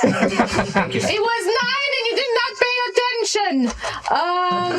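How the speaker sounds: put-on high, strange young hag voice. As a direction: sneer like a young witch. GM answers in hag voice.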